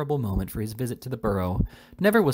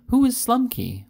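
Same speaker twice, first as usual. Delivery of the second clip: usual speaking voice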